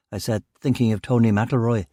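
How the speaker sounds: ordinary delivery, nothing marked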